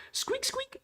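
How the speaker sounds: tiny, very high squeaks; squeaking. Acted. Voicing squeaking creatures in the storyboard, high squeaks. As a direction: squeak softly like a little animal. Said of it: "A bunch of squeaking and ribbiting".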